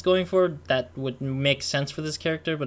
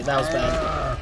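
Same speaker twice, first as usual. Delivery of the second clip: normal talking voice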